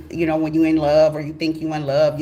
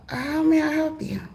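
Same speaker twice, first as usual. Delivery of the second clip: polite phone voice; 11 semitones higher than usual. Retold or imitated. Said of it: "I said".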